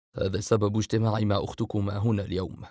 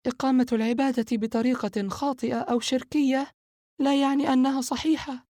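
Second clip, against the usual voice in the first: higher female voice, firm; Sara's voice. Acted. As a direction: correct your brother sharply but lightly. Sara objects to Fahd.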